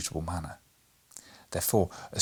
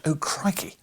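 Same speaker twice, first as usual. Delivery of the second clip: mock scream; 7 semitones higher than usual. A narrator's reading. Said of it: "he screamed out".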